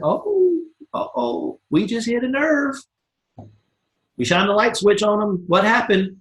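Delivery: high, teasing uh-oh; mock alarm. Coach acting a prospect call, teasing mock alarm. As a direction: play the coach spotting a weak point.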